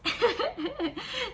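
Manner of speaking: high embarrassed giggle after singing; shy laugh at herself; light giggle; nervous laugh. Real, not acted. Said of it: "ちょっと歌うの緊張しますね".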